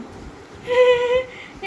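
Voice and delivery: sudden high burst of laughter. Real, not acted. Child doing something funny.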